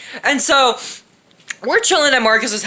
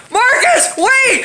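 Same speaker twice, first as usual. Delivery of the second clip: high-pitched warning cry; retold urgent call; 12 semitones higher, much slower than usual. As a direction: call out as if stopping a friend.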